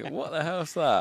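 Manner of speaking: slow, puzzled cow voice; bored cow. Acted. Farmer voicing a curious cow spotting a stranger, slow and puzzled. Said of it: "curious cows in a field".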